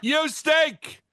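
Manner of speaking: boo the team with a punchy yell; mock disgusted shout. Jeering the Los Angeles offense, mock disgust. Grievance rant at players.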